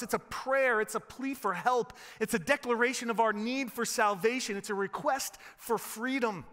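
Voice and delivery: ordinary talk, nothing marked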